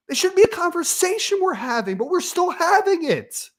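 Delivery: high-pitched real exasperation; super frustrated. Real, not acted. Host venting to viewers about losing out to Utah, frustrated. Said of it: "I'm sorry I'm frustrated".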